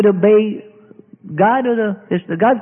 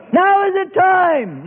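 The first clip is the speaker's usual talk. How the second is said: high, whiny pleading voice; high whining plea to the Lord; impatient believer; 9 semitones higher, much slower than usual. Acted. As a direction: nag like a child in the fire.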